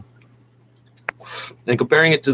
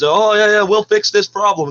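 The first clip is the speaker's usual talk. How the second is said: higher-pitched mock reassurance; mocking quote; 9 semitones higher, 3 dB louder than usual. Caller mimicking officials trying to convince people, mocking. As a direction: parrot their empty promise in a raised voice. Mocks their empty promise.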